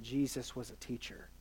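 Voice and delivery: very soft and low, almost under the breath; hushed confiding. Retold or imitated. Mentor passing on a lesson privately, hushed and confiding. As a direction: drop right down for a treasured saying. Retelling advice given privately aside.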